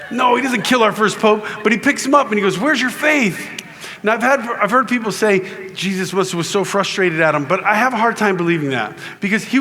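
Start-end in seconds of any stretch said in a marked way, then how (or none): 2.6-3.6 high, drawn-out mock reproach; chiding question. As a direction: stretch it out high, chiding a friend. Jesus addressing sinking Peter.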